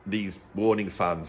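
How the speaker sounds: ordinary talk, nothing marked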